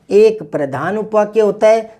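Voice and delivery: usual speaking voice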